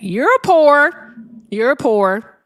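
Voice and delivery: loud, drawn-up jeer; loud mocking put-down; loud taunt. Real, not acted. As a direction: throw it at the caller loudly. Taunting caller over their insults.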